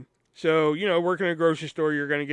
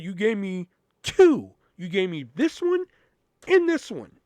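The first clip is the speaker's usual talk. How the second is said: heated retort; 5 semitones higher, 5 dB louder than usual. Retold or imitated. Cashier arguing back heatedly.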